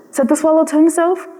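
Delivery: ordinary delivery, nothing marked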